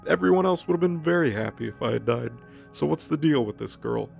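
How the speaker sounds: normal talking voice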